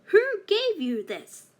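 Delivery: high, loud angry demand; witch shouting. A narrator's reading. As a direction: shout it at her, furious. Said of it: "shouted the old witch".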